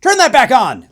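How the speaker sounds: high, loud playful yell; mock yell. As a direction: jolt up and shout it for a laugh. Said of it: "in the car, went".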